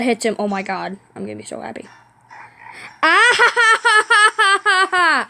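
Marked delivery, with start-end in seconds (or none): 3.0-5.3 high staccato ha-ha laugh; gleeful laugh. Real, not acted. Gamer gloating over a hit in the game, gleeful. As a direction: laugh it out in triumph.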